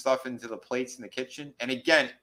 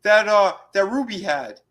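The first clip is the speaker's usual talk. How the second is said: high, loud hammed-up woman's voice; mock shrill alarmed woman; high mock heroine; 10 semitones higher, 6 dB louder than usual. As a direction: play her up high and alarmed. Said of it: "She goes".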